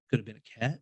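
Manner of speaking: low, soft, tentative guess; skeptical musing. Retold or imitated. Skeptic debunking a noise to himself, musing. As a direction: think it through quietly as a skeptic. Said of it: "I'm the skeptic guy".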